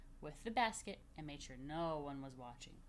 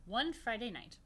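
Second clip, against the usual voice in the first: plain reading voice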